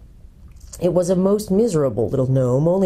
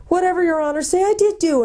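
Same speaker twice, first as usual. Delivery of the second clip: high-pitched cowed creature voice; squealing gnome. A narrator's reading. Captured gnome agrees to anything his captor says, squealing. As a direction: answer up high, eager to placate. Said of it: "squealed the Earthman".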